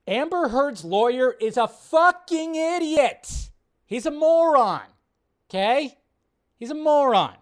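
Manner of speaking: loud, slow, furious insult; angry rant. Real, not acted. Streamer ranting at the lawyer, loud and angry. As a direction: shout your contempt at the lawyer.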